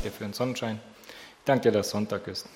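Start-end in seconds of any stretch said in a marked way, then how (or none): none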